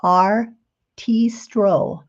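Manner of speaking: Artistro said very slowly, syllable by syllable; Artistro, each syllable drawn out; name spelled out slowly. Shown as a demonstration. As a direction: drag out Artistro so viewers catch the brand. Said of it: "I'm gonna show you the name of this".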